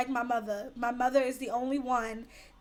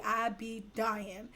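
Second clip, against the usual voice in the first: usual speaking voice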